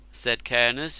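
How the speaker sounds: usual speaking voice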